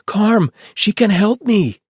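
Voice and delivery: put-on very high, loud child's voice; a little kid desperate to be chosen; eager little girl. A narrator's reading. Rosie pleading with Chuck for the hatchet, high loud child. Rosie continuing her shout.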